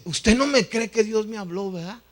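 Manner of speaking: high, loud accusation of disbelief; pressing, indignant-sounding retold question; insistent challenge. Drunkard challenges his disbelief.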